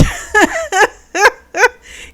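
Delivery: high bright laugh breaking out; sudden real laugh; burst of laughter. Real, not acted. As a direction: laugh out loud at how awkward it was. Amused laugh at the story.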